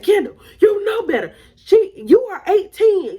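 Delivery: high, loud, emphatic scolding; imitated mom reprimand, hammering each word; mama yelling. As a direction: lay into the older girl like an angry mother. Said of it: "she's yelling at both of us".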